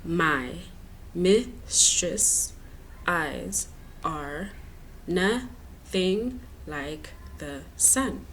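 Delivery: "My mistress' eyes are nothing like the sun" with long gaps between syllables; counting syllables. Shown as a demonstration. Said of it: "let's count them".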